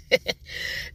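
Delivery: soft airless laugh; a quick delighted giggle; breathy giggle. Real, not acted. Laughs at cute couple.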